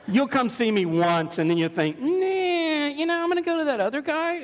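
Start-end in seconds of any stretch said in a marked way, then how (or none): 2.0-4.4 high, fast mock client brushing him off; mock dismissive client. Pastor mocks a client choosing another guy, high and fast. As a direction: ham up a client who picks another counselor. Mocking a client who leaves.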